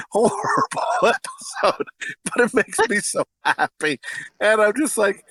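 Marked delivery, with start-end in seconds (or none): 0.1-1.9 high, slow, laughing on the word; breathless laugh. Real, not acted. Fan describing the awful episode, laughing. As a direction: crack up mid-word.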